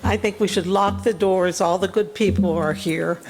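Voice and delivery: ordinary delivery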